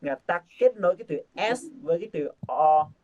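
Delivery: ordinary talk, nothing marked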